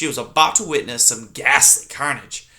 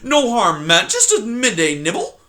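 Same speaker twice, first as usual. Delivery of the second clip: high, loud, sniveling fox; 11 semitones higher, 5 dB louder than usual. Acted. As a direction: grovel to the porcupine.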